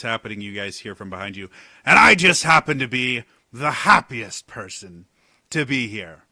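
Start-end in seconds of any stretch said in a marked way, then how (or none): 1.8-6.2 loud, beaming, overly cheerful newcomer; overjoyed stranger. Acted. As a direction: burst in gushing with fake delight. Cheery stranger calls from behind.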